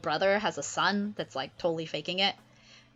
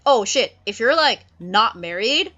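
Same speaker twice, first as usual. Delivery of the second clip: much louder, excited blurt; eager Ferdinand; 4 semitones higher, 9 dB louder than usual. Acted. Ferdinand offers to marry Miranda, loud and excited. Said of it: "Ferdinand's like".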